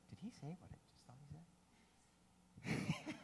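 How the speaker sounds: breathy voiceless whisper of a shocked onlooker; hushed quick aside in a whisper. Retold or imitated. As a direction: whisper it fast to your neighbour in the crowd. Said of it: "kind of whispering".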